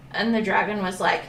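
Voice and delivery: plain reading voice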